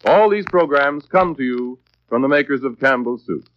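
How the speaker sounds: ordinary delivery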